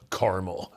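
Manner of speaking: caramel said as "CAR-mul"; two-syllable caramel. Retold or imitated. Quoting people he asked, caramel as CAR-mul. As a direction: imitate others saying caramel as CAR-mul. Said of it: "and they were like".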